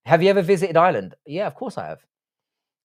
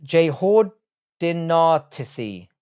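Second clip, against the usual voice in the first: slow careful spelling; 2 semitones higher, 3 dB louder, much slower than usual. Shown as a demonstration. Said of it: "such a hard word to spell".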